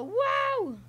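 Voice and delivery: very high, loud whooping "wow"; demonstrated whoop. Shown as a demonstration. Player showing how to do the move, whoop. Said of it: "And then you go".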